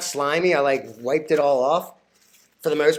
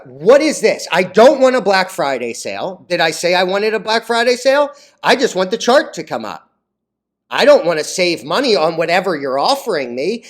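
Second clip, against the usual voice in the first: louder, fed-up complaint; real irritation, louder; annoyed rant; 4 semitones higher, 8 dB louder than usual. Real, not acted. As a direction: vent at the popup. Angry at a website popup.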